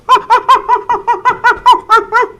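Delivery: loud high monkey call; gorilla hoot. Acted. Gorilla suit worker performing for zoo kids, loud ape hoot. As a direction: hoot like an ape after the banana. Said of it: "So here I go and go".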